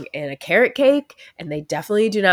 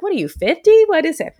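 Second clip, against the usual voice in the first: mock incredulous; 8 semitones higher, 4 dB louder than usual. Mocking son's old-man taste.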